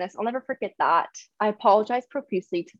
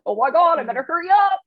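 flustered doctor; 8 semitones higher, 4 dB louder than usual. Retold or imitated. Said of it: "the poor doctor was like".

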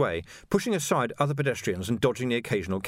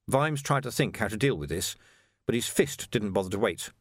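ordinary delivery, nothing marked

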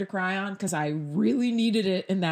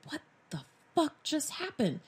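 shell-shocked; 6 semitones higher, 6 dB quieter than usual. Retold or imitated. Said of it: "super shell-shocked".

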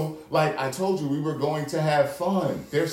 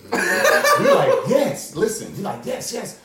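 gleeful yes; 9 semitones higher, 4 dB louder, slower than usual. Retold or imitated. Cheering while chasing the cab.

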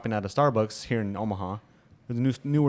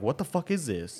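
annoyed customer; 4 semitones higher, 3 dB quieter than usual. Retold or imitated. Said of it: "makes a shitty ass annoyed face".

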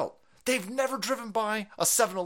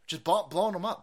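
ordinary talk

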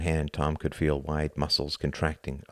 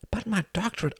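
weak objection; 3 dB quieter than usual. Acted. Hart objects weakly.

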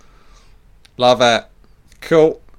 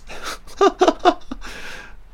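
high cackle. Pretend. High cackle after [name] voice.

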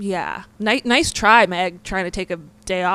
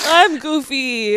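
slow, laugh-cracked words; 7 semitones higher, 4 dB louder, much slower than usual. Real, not acted. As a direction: giggle through it. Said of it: "I'm a goofy girl today".

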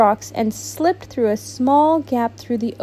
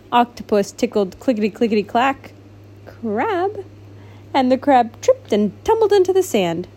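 plain reading voice

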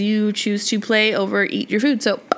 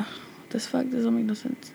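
whisper; 10 dB quieter than usual. Real, not acted. Disbelief at parenting list.

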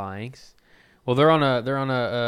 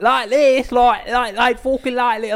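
high, loud mock British accent; British lads impersonation, pitched way up; imitated British accent; 13 semitones higher, 10 dB louder, slower than usual. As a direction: put on a loud, exaggerated Brit voice.

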